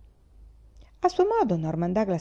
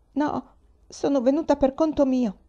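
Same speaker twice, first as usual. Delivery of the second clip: high little voice, not raised; timid girl; 6 semitones higher than usual. A narrator's reading. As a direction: answer meekly but plainly, pitched up. Frightened girl answers shouting man.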